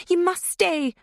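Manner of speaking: a prankster girl crying out a mock command. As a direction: order her to stay like a cult leader. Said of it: "Leah cried".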